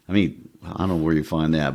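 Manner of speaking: ordinary talk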